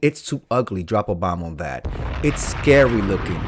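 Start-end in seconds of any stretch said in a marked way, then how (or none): 0.8-1.9 low, soft deadpan drop; low catchphrase. Acted. Host lands his catchphrase low and soft.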